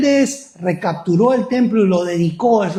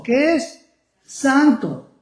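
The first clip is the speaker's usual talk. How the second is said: high call, answered right back; preacher's call and answer; 8 semitones higher, much slower than usual. Acted. Preacher calling to the congregation again, high call-and-response. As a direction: lift the room with the question.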